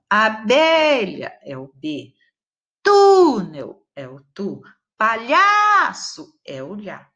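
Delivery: "Abelha é o B", "Túnel é o tu", "Palhaço é o lhá" said slowly, each stressed syllable called out clearly; naming the stressed syllable. Shown as a demonstration. Teacher slowly calls out the stressed syllable to her pupils. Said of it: "A sílaba tônica da abelha".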